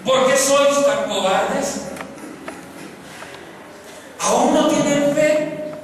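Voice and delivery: alto, fuerte y lento, regañando; reproche severo. Acted. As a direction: increpa a los cobardes con fuerza. Jesús reprende a los discípulos. (Jesús regaña a sus discípulos, fuerte y pausado)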